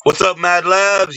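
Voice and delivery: high, slow, drawn-out shout-out; big stretched hello; hyped greeting. Real, not acted. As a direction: call out the name like a hype man. Loud hyped greeting to viewer.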